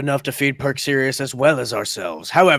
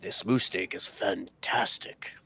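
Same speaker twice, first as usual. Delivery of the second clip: hushed, savoring whisper; 5 semitones lower, 7 dB quieter than usual. Acted. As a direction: whisper it like a delicious secret. Player savors food in character.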